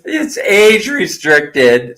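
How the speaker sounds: mock the rule while cracking up; laughing repeat. Real, not acted. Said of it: "I started busting up laughing".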